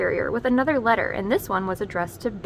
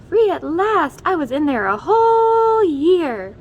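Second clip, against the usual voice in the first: high, loud cry of freedom; joyful shout; 7 semitones higher, 9 dB louder, slower than usual. Acted. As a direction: shout it out, finally free. Candy freed from the tree.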